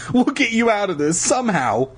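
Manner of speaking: high, plaintive promise; distressed girl. A narrator's reading. Lauren consoles frozen sister.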